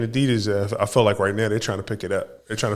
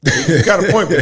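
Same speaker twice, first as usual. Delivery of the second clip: laughing out the words in a burst; 5 semitones higher, 6 dB louder than usual. Real, not acted. Cohost laughing at his partner's joke, laughing. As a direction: crack up while conceding the point.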